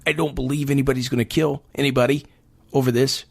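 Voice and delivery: usual speaking voice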